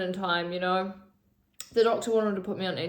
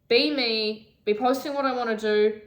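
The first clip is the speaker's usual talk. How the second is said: ordinary talk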